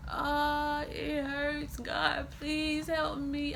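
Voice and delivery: reliving a labour scream for the listeners; screaming in pain. Retold or imitated. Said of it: "I was screaming like".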